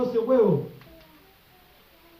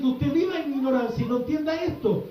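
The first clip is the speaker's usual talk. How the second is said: louder, driving sermon build to a climax; preaching at full force; 5 semitones higher, 4 dB louder than usual. Acted. Preacher to congregation about living from heaven, fervent preaching.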